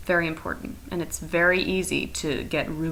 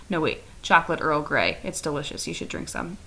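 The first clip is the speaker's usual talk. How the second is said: ordinary talk, nothing marked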